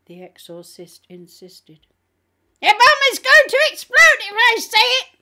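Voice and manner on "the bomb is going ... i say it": high, very loud demonic screaming; demon's shout. A narrator's reading. Demon refusing to say the prayer, frantic shout. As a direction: shout it frantically at full volume. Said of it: "the demon shouted".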